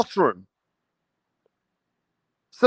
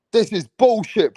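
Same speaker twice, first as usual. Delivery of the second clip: ordinary talk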